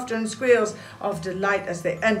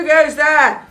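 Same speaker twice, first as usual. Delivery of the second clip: loud, booming challenge from a toy soldier; 6 semitones higher, 9 dB louder than usual. Acted. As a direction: boom it out like a sentry on guard. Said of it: "in a booming voice".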